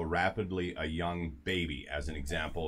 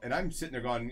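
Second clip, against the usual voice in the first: ordinary delivery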